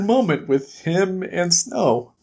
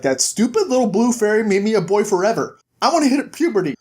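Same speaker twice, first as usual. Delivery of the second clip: put-on high petulant kid whine; whiny little boy voice; 6 semitones higher, faster than usual. Pinocchio complains to Beauty, high bratty whine. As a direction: whine like a cranky boy stuck young.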